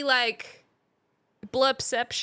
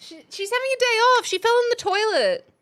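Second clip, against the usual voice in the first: high mock excuse; 10 semitones higher, 5 dB louder than usual. Joking excuse, high and loud.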